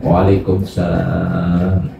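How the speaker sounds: slow, soft, smiling answer; smiling reply. Acted. The Sheikh smiles at the drunk youth, slow and soft. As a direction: gently return the greeting with a smile. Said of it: "Sambil tersenyum".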